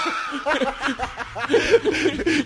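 high, helpless burst of laughter; real high-pitched laughing fit. Real, not acted. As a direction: crack up for a couple of seconds. Cracking up over the photo.

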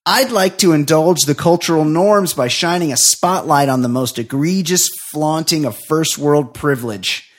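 mock-serious op-ed delivery; scripted comic read. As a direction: read it like a pompous editorial. Reads scripted comic rant.

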